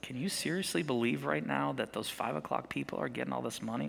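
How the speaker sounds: low, soft, conspiratorial gossip; mock party-corner whisper of complaint; hushed gossip. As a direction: lean in and grumble it under your breath. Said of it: "talking about it like this".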